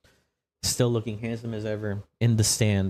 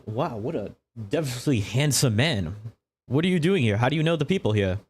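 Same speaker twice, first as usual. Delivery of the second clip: showy on-camera interviewer, higher pitch; lilting, higher presenter voice; Orion Seacrest; 3 semitones higher than usual. Acted. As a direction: play a TV host working the crowd. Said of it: "Orion Seacrest is going to float around".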